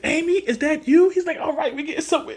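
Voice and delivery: high hopeful cartoon voice, quickening at the end; dazed Sonic. Sonic spots a blurry Amy, high and hopeful. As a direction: pitch way up, relieved.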